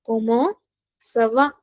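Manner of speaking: "Comment", rising, pause, then "ça va"; separated teaching delivery of "Comment ça va"; word by word. Shown as a demonstration. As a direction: break "Comment ça va" in two for learners. Teacher dictates phrase in pieces.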